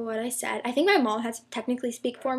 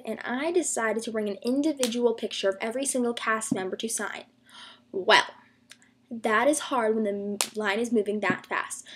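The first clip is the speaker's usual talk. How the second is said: ordinary delivery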